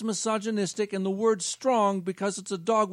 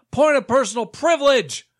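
said louder, pressing the point; insistent and louder the second time; louder insistent repeat; 3 semitones higher, 7 dB louder than usual. Acted.

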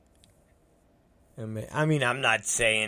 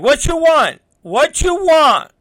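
put-on high, loud impatient kid voice; shrill-free but sharp kid yelling what you want; kid demanding; 12 dB louder than usual. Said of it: "he's like".

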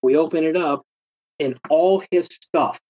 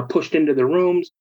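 usual speaking voice

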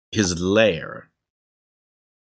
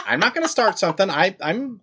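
ordinary talk

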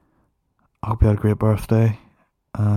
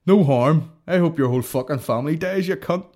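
higher, hammed-up insult; mock abusive outburst; 5 semitones higher, 4 dB louder than usual. Podcaster parodying his mate's no-harm insults, mock outburst. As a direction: open loud, then throw the curse out high.

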